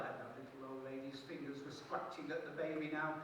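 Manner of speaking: ordinary delivery, nothing marked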